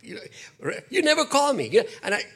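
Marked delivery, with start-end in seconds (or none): 0.9-1.7 high nagging mom voice; mock guilt-tripping mother; imitating a mother. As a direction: complain like a mom left waiting by the phone. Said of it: "Have you ever had a mother call?".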